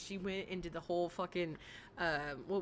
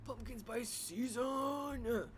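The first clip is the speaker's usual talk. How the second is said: dreamy announcement; 3 semitones higher, much slower than usual. Pretend. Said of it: "announces season".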